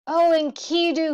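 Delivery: high, loud anguished cry. A narrator's reading. Gilgamesh crying out over dead Enkidu, high and loud. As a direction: cry his friend's name in grief. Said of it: "he cried".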